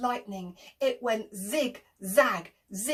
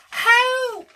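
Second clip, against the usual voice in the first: high, loud cry for help; shouted help; 12 semitones higher, 10 dB louder than usual. Acted. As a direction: yell it like a sailor in a storm. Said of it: "shouted Jim".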